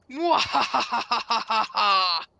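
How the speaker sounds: slow loud villain laugh; evil cackle. Acted. Villain laugh after arriving.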